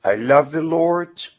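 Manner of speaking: normal talking voice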